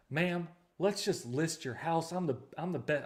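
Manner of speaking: put-on southern accent. Realtor pitching to a homeowner, southern accent. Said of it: "I don't know why I got a southern accent".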